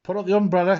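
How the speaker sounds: high, loud order; captain's storybook shout in the rain; urgent cry. A narrator's reading. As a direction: bark it at the crew. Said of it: "cried the captain".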